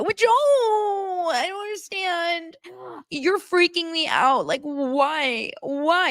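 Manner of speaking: high, whiny mock freak-out; Madison. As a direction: imitate a tearful, exasperated fiancée. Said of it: "without her freaking out".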